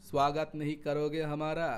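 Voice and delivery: ordinary talk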